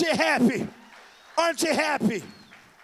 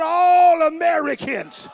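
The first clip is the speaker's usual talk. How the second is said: louder and slower, each word pushed; louder, slower call; 4 semitones higher, 10 dB louder, slower than usual. Real, not acted. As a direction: really pull the shout out of them. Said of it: "Somebody shout".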